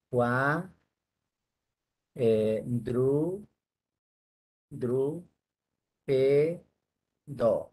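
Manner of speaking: "cuadrúpedo" broken into cua, dru, pe, do with pauses; syllable by syllable. Shown as a demonstration. Teacher checking the syllables of "cuadrúpedo", said one by one. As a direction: sound out "cuadrúpedo" slowly, each syllable held apart. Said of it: "Vamos a ver si tiene cuatro cabezas".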